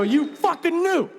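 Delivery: high, strained accusing whine; mock furious accusation at him; accusing fan.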